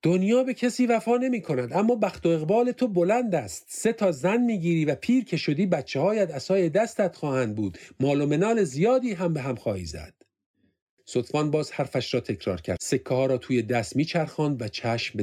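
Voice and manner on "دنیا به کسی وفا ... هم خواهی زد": higher, louder soothsayer voice. Retold or imitated. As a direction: prophetic fortune teller, fading down by the end. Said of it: "زنی کولی ... گفته بود".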